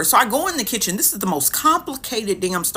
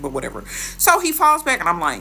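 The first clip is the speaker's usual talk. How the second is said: usual speaking voice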